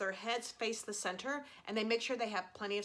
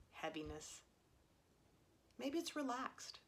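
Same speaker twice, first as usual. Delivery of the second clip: calm meditation guide, long pauses between cues; guided meditation; 3 semitones lower than usual. Real, not acted. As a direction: gently lead them through a body scan, leaving room to breathe. Leads a body scan.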